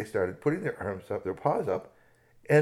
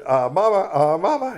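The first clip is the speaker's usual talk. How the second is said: nervous plea; 9 semitones higher, 12 dB louder than usual. Acted. Nervous plea to charging bear.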